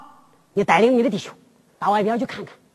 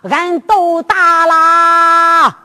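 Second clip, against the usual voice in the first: 拖长声邀功; 5 semitones higher, 7 dB louder, much slower than usual. Acted. 众衙役拖长声邀功.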